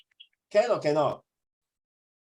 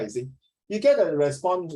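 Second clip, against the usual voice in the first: normal talking voice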